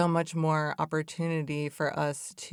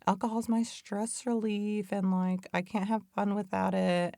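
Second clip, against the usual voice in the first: higher, mock-whiny voice of the addicted self; addictive voice; 5 semitones higher than usual. Mocking what the addicted self tells you, higher whiny voice. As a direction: play the excuse-making addict in your head. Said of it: "you're like".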